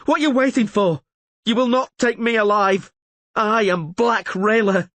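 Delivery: high, loud defiant challenge; savage scream. A narrator's reading. Surrounded Rayla screaming defiance at the elves. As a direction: roar defiance at the circling enemy. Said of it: "she screamed savagely".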